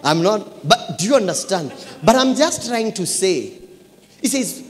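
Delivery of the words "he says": usual speaking voice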